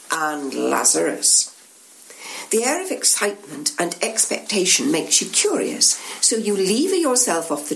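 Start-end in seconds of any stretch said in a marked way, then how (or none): 0.1-1.5 lower, slow, hushed echo of Lazarus; knowing echo. Acted. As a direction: drag the name out darkly. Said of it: "They nod together significantly".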